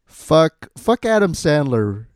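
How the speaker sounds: a sudden loud cursing burst; loud outburst. Real, not acted. Cohost ranting about Adam Sandler, loud outburst. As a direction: blurt it out loud and high.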